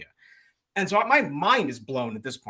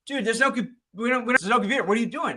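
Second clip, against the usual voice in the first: mock incredulous; 7 semitones higher, faster than usual. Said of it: "Chris laughs at him and goes".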